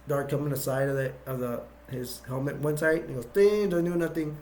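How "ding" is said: high ringing "ding" sound effect; imitated ding. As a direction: voice the clang of a hit. Imitates the helmet being hit.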